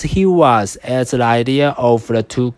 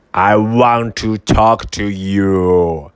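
low, slow hungry wolf voice; werewolf; 3 semitones lower, slower than usual. Acted. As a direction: lure the pig out slowly. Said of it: "said the wolf, with his mouth watering".

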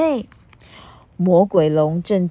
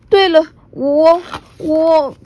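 loud, higher, gathering courage; sudden resolve; 11 semitones higher, 6 dB louder than usual. Acted. T-rex remembering his promise, rising resolve.

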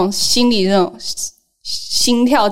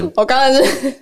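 stifled giggles breaking through; holding back laughter; trying not to laugh; much faster than usual. Real, not acted. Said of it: "憋笑憋到不行".

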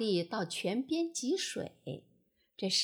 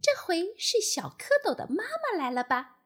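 tadpole wondering; 7 semitones higher than usual. A narrator's reading. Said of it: "心里想".

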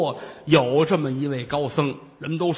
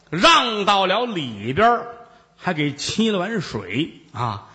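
ordinary talk

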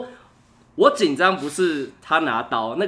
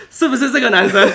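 边笑边模仿; 8 semitones higher, 8 dB louder than usual. Retold or imitated. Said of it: "他爸就突然拿着菜刀冲出来".

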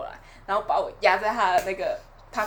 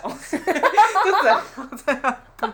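slow, giggling through the words; cracking up at her own story; laughing while telling; 8 semitones higher, 9 dB louder, slower than usual. Real, not acted. Friend laughs while describing her brother's belly.

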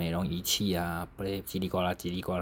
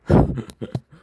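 a sudden loud burst of laughter; loud breathy laugh; 5 semitones higher, 12 dB louder than usual. Real, not acted. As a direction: crack up loudly. Laughing after mom's retort.

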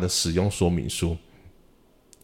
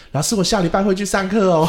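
put-on much higher, louder happy kid voice; cheerful child; 10 semitones higher, 4 dB louder than usual. Student tells the teacher he will come next week, high cheerful kid voice. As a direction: chirp it like a boy in a good mood again. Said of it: "他就说".

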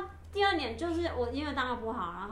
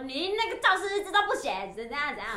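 higher, louder mock telling-off; imitated scolding; 5 semitones higher, 4 dB louder than usual. School director to student over an unwritten log, mock scolding. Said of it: "我就被骂臭头".

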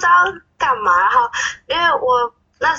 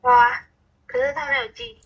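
normal talking voice

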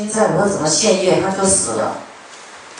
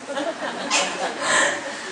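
quiet high laugh; 7 semitones higher, 10 dB quieter than usual. Real, not acted. Laughs after acted scolding.

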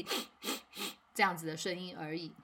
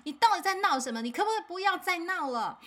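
imitating a fed-up parent's higher, louder scolding; exasperated parent; 10 semitones higher, 4 dB louder than usual. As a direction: snap back at the nagging kid. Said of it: "成人的情绪被他挑起来了".

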